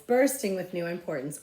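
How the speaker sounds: ordinary delivery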